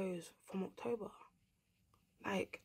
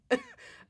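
high laugh. Real, not acted. Laughs after swearing.

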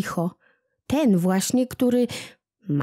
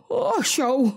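"osioł" flung out higher and sharper; angry scolding; 7 semitones higher than usual. Acted. Old Smętek angrily scolding kłobuk, "osioł" higher and sharper. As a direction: let the second "osioł" burst out in anger. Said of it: "rozgniewał się smętek".

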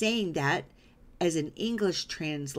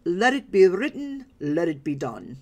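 commanding royal pronouncement, voiced big and louder; Yul Brynner impression; 5 dB louder than usual. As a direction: proclaim it like a movie pharaoh. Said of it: "As Yul Brynner would say".

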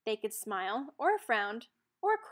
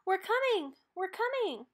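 higher, louder rescue call; 8 semitones higher, 4 dB louder than usual. A narrator's reading. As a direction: call out to the child in the water. Captain rushes to rescue child.

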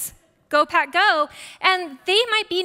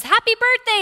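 much higher and louder, bright sing-song "happy birthday"; a lightly voiced cheerful husband; husband's cheery greeting; 12 semitones higher, 7 dB louder than usual. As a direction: play the husband chirping a cheerful wish. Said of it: "he goes".

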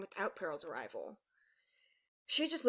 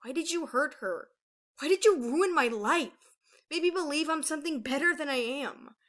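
higher, insistent wounded demand; anguished accusation; 6 semitones higher, 5 dB louder than usual. A narrator's reading. Aurora to her mother's killer, anguished and accusing. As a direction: demand answers, hurt and angry.

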